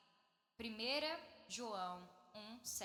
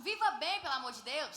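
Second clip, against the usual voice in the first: loud, high exasperated plea; much louder and higher, dropping on the last words; emphatic intonation; 4 semitones higher, 9 dB louder than usual. Shown as a demonstration. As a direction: belt it out, imploring them. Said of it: "Agora dá a entonação".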